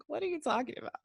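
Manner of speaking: high, soft confused question; small, high-pitched puzzled voice; acted bafflement. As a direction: imitate someone totally lost.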